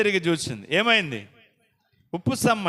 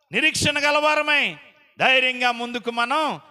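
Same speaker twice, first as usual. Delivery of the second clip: raised, slow, ringing preacher's call; fervent preaching; 7 semitones higher than usual. Acted. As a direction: rouse the congregation forward. Preacher exhorting at full force. (ఉత్సాహంగా బోధించే స్వరం)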